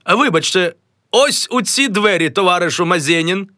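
high, smug well-fed voice; sated voice. Acted. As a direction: speak like a pleased, well-fed official. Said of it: "ситим голосом".

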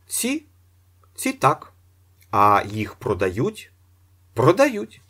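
high, brisk salesman voice; shop clerk. A narrator's reading. Seller answering Ostap about chairs, voiced high. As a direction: play a clipped shop seller.

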